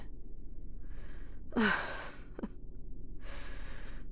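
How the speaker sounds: slow quiet exasperated sigh; a drawn-out fading sigh; long sigh. Acted. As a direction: sigh it out, worn down. Frustration over assassination attempt.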